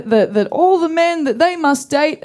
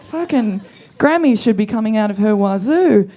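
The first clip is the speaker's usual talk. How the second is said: ordinary talk, nothing marked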